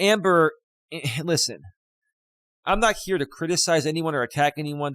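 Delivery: ordinary delivery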